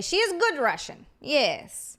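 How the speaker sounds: put-on Svetlana's Russian accent, broken English. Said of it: "really not a bad Russian accent".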